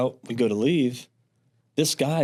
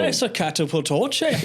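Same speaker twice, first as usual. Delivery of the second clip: put-on light Irish accent, higher pitch; 7 semitones higher than usual. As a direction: try a quick Irish accent. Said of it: "like, an Irish accent?".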